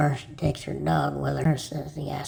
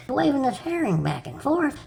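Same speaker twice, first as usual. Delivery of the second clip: usual speaking voice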